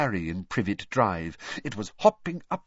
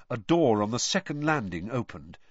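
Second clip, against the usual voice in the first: plain reading voice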